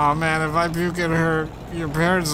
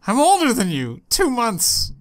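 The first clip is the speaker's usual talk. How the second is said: higher, louder, insistent; indignant protest; 8 semitones higher, 3 dB louder than usual. Acted. Mae protesting to Bea that she is older, indignant. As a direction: object loudly like a child. Drunk argument with friend in car.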